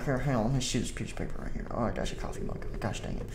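quiet whispered mumble; muttered aside. Acted. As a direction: mutter to yourself.

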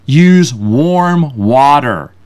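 very slow, drawn-out words; deliberate label reading. Retold or imitated. Reading the face paint bottle aloud, slow and deliberate. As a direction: read the instruction out word by word.

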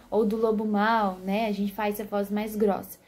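ordinary talk

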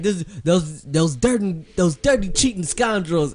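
lightly voiced indignant commentator; biased announcer. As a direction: sneer at the cheaters. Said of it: "he's always biased".

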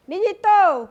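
high, loud call to someone inside the house. Acted. Aunt calls Jeeto from outside her house, loud and high.

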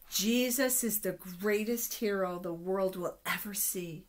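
plain reading voice

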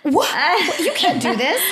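high, loud, laughing disbelief; incredulous laughing protest. Retold or imitated. Producer follows her into bar.

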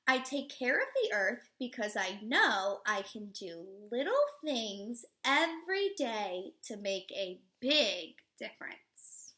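slow, expressive storybook read-aloud; reading to children. Acted. Teacher reading a picture book to children, expressive read-aloud. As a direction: read it warmly to a class of little kids.

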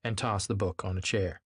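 usual speaking voice

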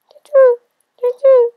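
very high, loud owl hooting; owl hoots. Acted. Owl startled by the mouse's story, high hooting. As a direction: hoot twice like a startled owl. Owl's hoot voiced by reader.

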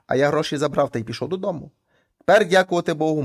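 usual speaking voice

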